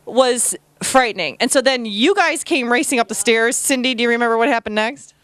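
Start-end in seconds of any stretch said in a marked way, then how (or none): none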